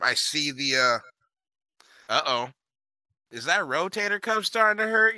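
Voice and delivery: usual speaking voice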